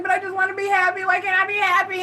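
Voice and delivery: high, fast, tearful whine recalling the breakdown; imitating my crying past self, high and quick; retold crying plea. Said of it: "and I was crying".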